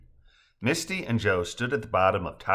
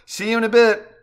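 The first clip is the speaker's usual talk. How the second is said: high, loud call down; raised, carrying voice; hollered farewell; 12 semitones higher, 7 dB louder than usual. A narrator's reading. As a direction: shout a cheery goodbye from above. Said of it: "Misty hollers down".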